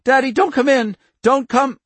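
high, loud frightened warning; shrill fear. A narrator's reading. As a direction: scream the warning, terrified. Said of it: "the shrill fear in her voice".

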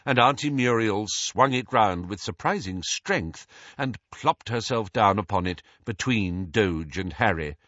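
ordinary delivery

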